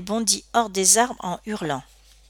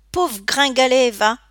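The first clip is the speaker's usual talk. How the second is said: raised, loud scornful insult; contemptuous jeer; 5 semitones higher, 5 dB louder than usual. A narrator's reading. Bully Scott insulting the scrawny boy, contemptuous. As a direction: throw it out with contempt.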